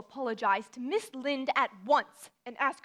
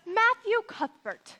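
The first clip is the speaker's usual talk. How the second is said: stern scolding; 10 semitones higher, 6 dB louder, slower than usual. Acted. Scolding brother over his heart.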